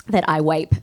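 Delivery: "wape" with a W instead of V. Retold or imitated. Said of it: "he says his V's as W's".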